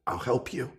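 soft, gentle offer of help; quiet reassurance. Retold or imitated. Stranger offering help to his dad, gently reassuring. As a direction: say it softly, like a lifeline.

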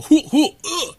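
high, loud grunting as if punched, laughing; fight grunts. Retold or imitated. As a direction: grunt like a cartoon fighter taking hits. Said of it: "so when he would punch and go".

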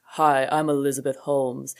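put-on deep voice, slowed down; Elizabeth Holmes. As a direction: drop into a mock deep, measured voice. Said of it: "she talked in this very deep voice".

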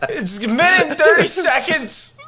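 high laughing yell; fed up, half laughing; exasperated shout. Real, not acted. Exasperated at friend's whispering bit.

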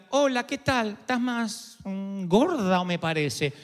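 slow, catty put-down voice; snide greeting. Retold or imitated. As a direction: drawl the "gorda" like a sly insult. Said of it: "No es lo mismo que te digan". (saludo burlón y malicioso, despacio)

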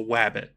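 "wabbit" with a W for the R; cartoonish W sound starting "wabbit"; lisped R as W. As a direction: say "wabbit", rounding R into W. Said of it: "he says wabbit".

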